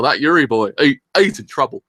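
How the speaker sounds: normal talking voice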